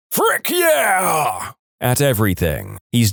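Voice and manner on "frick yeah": high-pitched drawn-out scream; screamed catchphrase. Acted. Guy screams his catchphrase at everything, screaming. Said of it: "His thing is to scream".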